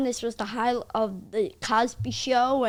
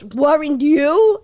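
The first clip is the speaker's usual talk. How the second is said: loud, slow, higher-pitched retort; emphatic comeback; 4 semitones higher, 11 dB louder, much slower than usual. Retold or imitated. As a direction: throw the question back, stretched out. Cosby's comeback, acted out.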